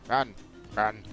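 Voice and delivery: normal talking voice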